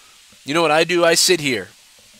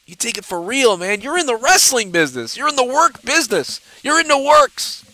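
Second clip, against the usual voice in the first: exasperated protest; 9 semitones higher than usual. Real, not acted. Host scolds rival for taking a joke seriously, rising exasperation. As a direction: pitch climbing higher with each repetition.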